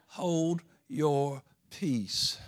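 "Hold your peace" drawn out slowly, each word weighted; slow, deliberate hold your peace. Shown as a demonstration. Preacher showing the congregation how to say it, slowly. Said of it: "then say".

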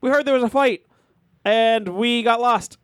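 high, slow mock-innocent excuse. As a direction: play dumb, high and drawn out. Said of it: "we're like".

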